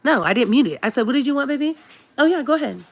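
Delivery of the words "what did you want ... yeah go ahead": put-on high, gentle mom to her child; sweet mom voice. Mom answering her daughter during the live, sweet and high. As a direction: coo it sweetly at your kid.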